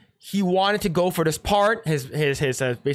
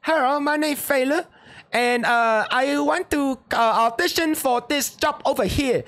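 playful mock Chinese accent, pitched up; 6 semitones higher than usual. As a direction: put on an exaggerated Chinese accent to pitch himself for the role. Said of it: "Whether, like".

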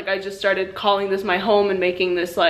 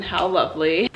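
ordinary delivery

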